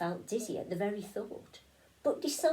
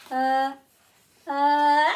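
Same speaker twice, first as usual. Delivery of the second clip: rising louder pre-sneeze uhs; sneeze building up; 6 semitones higher, 13 dB louder than usual. Acted. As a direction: wind up to a sneeze.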